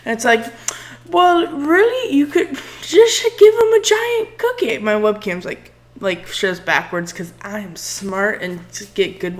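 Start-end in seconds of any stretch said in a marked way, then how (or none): 1.1-4.8 mock disbelief. Mock exasperated suggestion.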